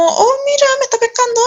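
high-pitched girlish delight, retold; smitten surprise. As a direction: imitate being thrilled at getting noticed. Acting out her delighted reaction.

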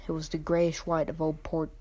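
ordinary delivery, nothing marked

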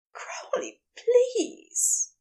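breathless plea, rising from hushed to high; shaking with need. A narrator's reading. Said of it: "his voice shaking with need".

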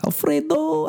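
very high pleading voice. Pretend. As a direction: protest in high squeaky panic. Mock quarrel with wife.

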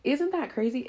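ordinary delivery

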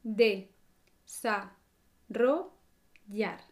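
De, sa, ro, yar split into separate syllables with pauses. Shown as a demonstration. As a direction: spell out each syllable De sa ro yar. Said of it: "Empezamos con las sílabas".

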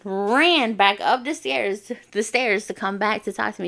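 usual speaking voice

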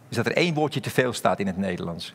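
usual speaking voice

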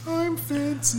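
squeaky-high mock fancy voice; silly high voice. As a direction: pipe it up very high, playfully. Joking about the fancy bottle.

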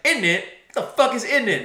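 high, loud scornful echo; mock indignant girlfriend. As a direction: throw the phrase back loudly and high. Girlfriend mocks his British phrase.

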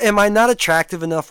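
ordinary delivery

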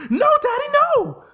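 very high squealed plea; child pleading with dad; screechy scared little boy; begging kid. Pretend.

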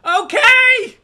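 very high, loud reply; hammed-up oblivious child shout; yelling kid. Kid answering Dad's hushing, mock high yell. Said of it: "just stop yelling".

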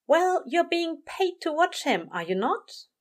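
high-pitched reasonable angel; pointed question, starting well up in pitch; prim angel. A narrator's reading. Aziraphale reminds Crowley he is paid, mildly pointed.

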